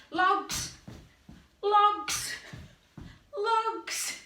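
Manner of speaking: loud high repeated shouts; excited game-leader yells; called-out cues. Acted. Calling out game instruction.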